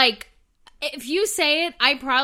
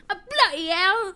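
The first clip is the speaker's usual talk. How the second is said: high, drawn-out mock-British exclamation; hammed-up British accent, slow and high; 7 semitones higher than usual. Showing off for an admiring co-host, in a British accent. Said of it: "I love [name] in an accent".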